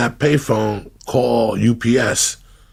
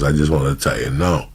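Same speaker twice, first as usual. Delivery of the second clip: imitating Darren, lower; 6 semitones lower than usual. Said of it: "He goes".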